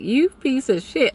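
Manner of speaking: high mock-disgusted jab; disgusted insult. Watching the guy flirt with others, mock disgusted. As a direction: sneer it under her breath. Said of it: "I'm like".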